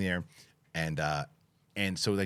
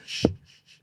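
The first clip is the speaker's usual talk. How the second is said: hushing; faster than usual. Retold or imitated. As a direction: re-enact hushing an angry driver. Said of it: "I go".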